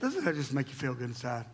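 soft laughing irony; mock the feel-good rhyme gently; wry irony. Real, not acted. Pastor joking to church about grim rhyme, softly laughing. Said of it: "ironic after grim rhyme".

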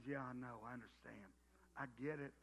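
very low, soft, soothing reassurance; God's gentle reply. Retold or imitated. God answering a sighing believer, low and soothing. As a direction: hush it like a tender father. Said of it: "And God says".

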